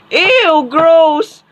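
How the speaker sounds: high-pitched, exaggerated revulsion; disgusted. Classmate recoiling from a dirty table, disgusted. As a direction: squeal it out in disgust.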